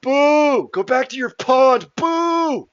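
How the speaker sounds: very high, loud booing from the crowd; jeering heckler. Acted. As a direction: heckle at full volume, high-pitched and drawn out. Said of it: "And the heckler goes".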